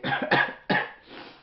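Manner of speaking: coughing from pepper heat; hacking cough, throat burning; harsh cough. Real, not acted.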